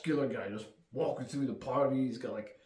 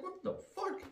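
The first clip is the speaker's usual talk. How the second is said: much higher, soft, dazed what the fuck; comic disbelief; baffled cry; 7 dB quieter than usual. Retold or imitated. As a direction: gasp it as you get stepped on. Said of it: "He's like".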